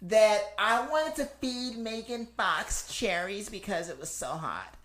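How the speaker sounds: high, drawn-out whiny celebrity voice; whiny Kardashian impression. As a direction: drag it out in a high, slow whine. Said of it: "one of them sounds like that".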